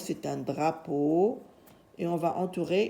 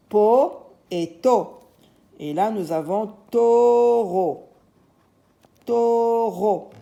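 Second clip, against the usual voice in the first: po et to slowly and louder, then taureau; teacher assembling taureau from syllables; slow syllable drill; 3 semitones higher, 5 dB louder, slower than usual. Shown as a demonstration. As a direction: build the word from its syllables slowly. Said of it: "la syllabe po".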